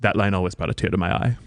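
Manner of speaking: low, quick British aside; British accent. Acted. Mother remembering her famous line, low British accent. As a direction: drop low in stage British. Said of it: "my accent is superb".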